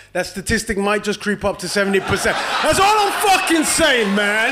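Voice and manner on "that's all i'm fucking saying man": shouted mock threat, a touch higher; comic threat to the heckler, laughing through it. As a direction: push the punchline out loud, half laughing. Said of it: "repeats it bigger".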